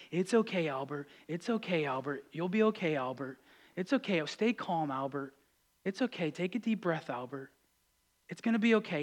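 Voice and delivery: put-on soft, hushed soothing of a crying baby; gentle soothing dad. Dad gently soothing his crying baby in a soft, hushed voice. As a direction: murmur it softly and gently, over and over. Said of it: "this dad is so gentle, so soft".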